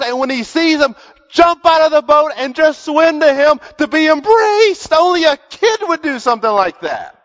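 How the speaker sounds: high, loud, driving sermon voice; preaching at full force. Acted. Preacher tells the congregation Peter swam like a kid, fervent and loud. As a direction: push it out to the back pew with fervour.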